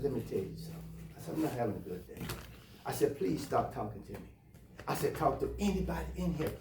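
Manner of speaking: hushed, tight-lipped plea to be left alone; quiet warning. Retold or imitated. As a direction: keep it soft but firm, holding back anger. Telling off pestering visitor lady.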